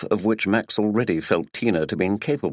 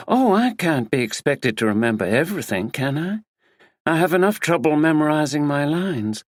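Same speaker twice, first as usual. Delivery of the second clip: flippant American actress; American accent; 6 semitones higher than usual. A narrator's reading. Actress Tina evading the detective, flippant American accent. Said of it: "She spoke in an American accent".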